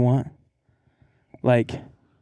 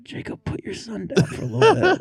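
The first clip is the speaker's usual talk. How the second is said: laughing call to Jacob; 8 semitones higher than usual. Retold or imitated. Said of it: "And I'm like".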